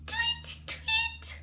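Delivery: high, soft little chirp; light, high-pitched birdsong; bird tweet. Acted. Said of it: "Can you tweet like a bird?".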